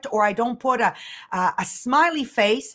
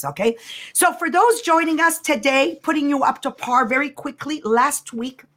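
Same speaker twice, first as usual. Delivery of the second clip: ordinary talk, nothing marked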